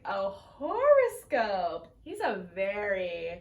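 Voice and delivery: slow, loud, drawn-out flamboyant gasp; a showy mock outrage at horoscopes; flamboyant exclamation. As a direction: ham up a flamboyant man's shock. Merriweather voiced in retold episode.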